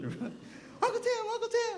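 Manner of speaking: very high-pitched, squealed imitation of a child; excited child calling. Grandniece runs to her uncle with her flute, excited. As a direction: call out like an eager little girl, drawn out.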